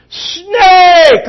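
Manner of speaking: high, very loud, drawn-out scream; panicked yell. Acted. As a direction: scream a startled warning at full volume. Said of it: "She yelled".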